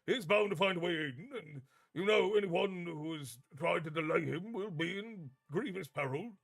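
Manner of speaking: a nervous man stalling for time; flustered old professor. Acted. Slughorn flustered protest.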